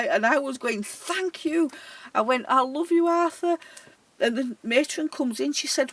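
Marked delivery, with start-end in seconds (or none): 0.8-1.7 high, drawn-out call to someone gone. Retold or imitated. Care worker calls thanks to dead Arthur, playful calling out. 2.5-3.6 high, louder mock call to Arthur. Care worker calls out to dead Arthur, mock-fond calling.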